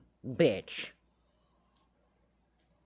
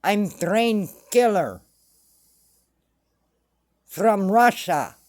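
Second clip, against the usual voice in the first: slow, mock Russian accent; deliberate Russian-accented delivery; slower than usual. Shown as a demonstration. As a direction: put on a Russian accent, article dropped, drawn out. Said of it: "can't even speak like a Russian would".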